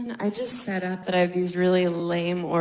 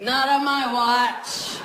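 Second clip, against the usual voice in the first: slow, mock-heroic line; slow heroic declaration; 2 semitones higher, 4 dB louder, slower than usual. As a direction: deliver it like a hero. Heroic quote with umbrella.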